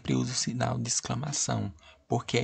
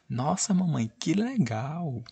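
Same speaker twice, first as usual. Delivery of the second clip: put-on much higher, slow, thrilled girl's cry; full exclamation; 6 semitones higher, slower than usual. Shown as a demonstration. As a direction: give the girl's line all the emotion. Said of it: "Pra dar mais emoção à frase da menina".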